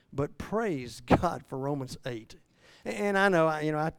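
ordinary delivery

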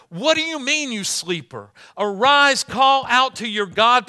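high, loud demanding cry; captain's shout. Pretend. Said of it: "So the captain said to Jonah".

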